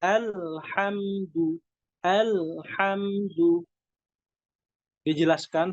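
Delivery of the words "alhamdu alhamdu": "Alhamdu" with a thin, light hamza, drawn out slowly. Shown as a demonstration. Teacher showing the light hamza in "Alhamdu", slow. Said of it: "Hamzahnya berharokat fathah".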